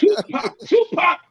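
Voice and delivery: high-pitched, drawn name; imitated movie line. Comic repeats favourite movie moment, high imitation. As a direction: quote the line in a high mock voice.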